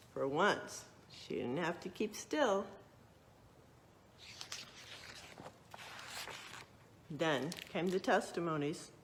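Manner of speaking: lively storybook narration; reading to children. A narrator's reading. Librarian reading a true story to children, lively narration. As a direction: read it out like a picture book for kids.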